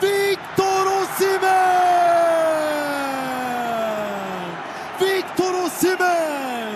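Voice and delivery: screaming football commentator. Real, not acted. Long drawn goal call.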